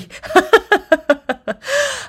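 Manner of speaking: high, loud laugh trailing down; giggling burst. Real, not acted. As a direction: laugh it off sleepily. Laughs about being sleepy.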